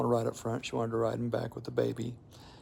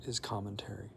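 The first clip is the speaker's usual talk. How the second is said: sudden hushed whisper; 3 semitones lower, 10 dB quieter than usual. Real, not acted. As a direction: drop to a breathy whisper for emphasis. Punchline of sermon point.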